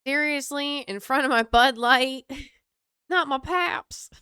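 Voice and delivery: put-on Southern accent. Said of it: "give them a Southern accent".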